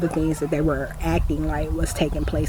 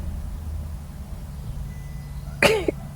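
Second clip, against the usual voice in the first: airy, disbelieving laugh up high; high breathy laugh; 7 dB quieter than usual. Real, not acted. Laughing in disbelief at the cop's name.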